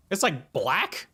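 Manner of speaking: normal talking voice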